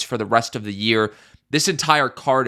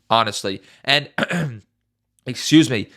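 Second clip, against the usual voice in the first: ordinary delivery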